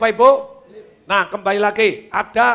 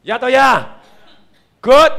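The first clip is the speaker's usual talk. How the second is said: usual speaking voice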